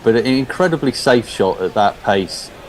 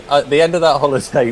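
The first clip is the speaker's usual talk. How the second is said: normal talking voice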